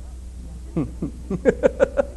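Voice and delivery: breathy, drawn-out chuckle. Real, not acted. Preacher amused that Peter will get old, chuckling. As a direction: laugh softly at your own joke. Laughs at own joke about Peter.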